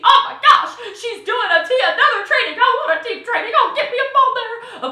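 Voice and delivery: high, loud and giggly, mock-excited; imitating an excited teammate. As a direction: bubble over like a thrilled teammate. Imitating an excited team member.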